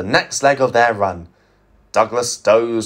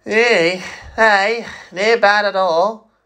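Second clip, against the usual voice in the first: pleased Scottish agreement; 9 semitones higher, slower than usual. Acted. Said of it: "agreed Donald".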